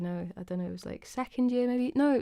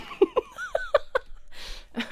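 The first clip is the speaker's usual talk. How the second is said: high-pitched laugh. Real, not acted. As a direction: giggle high and bright. Laughs at chat.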